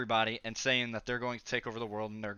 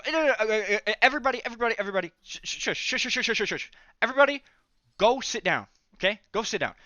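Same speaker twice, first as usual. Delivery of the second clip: higher, louder calls to shush the crowd; shooing everyone; 8 semitones higher, 5 dB louder, faster than usual. Retold or imitated. AJ telling the crowding classmates to sit down, loud and high. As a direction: wave the crowd away, loud and flustered.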